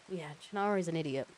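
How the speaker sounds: plain reading voice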